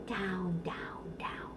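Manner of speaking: down sinks into a whisper; fading whisper. Shown as a demonstration. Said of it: "Okay, let's try it".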